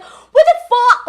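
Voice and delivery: high, loud, drawn-out retold outrage; laughing mock outrage, stretched and loud; upset. Retelling her reaction to his character, upset. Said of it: "I was so upset".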